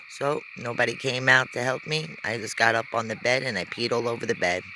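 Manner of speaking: ordinary talk, nothing marked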